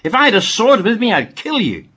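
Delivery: loud, high threatening yell; Balaam threatening the donkey, shouting; angry shout. A narrator's reading. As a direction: bellow the threat in rage. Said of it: "Balaam shouted".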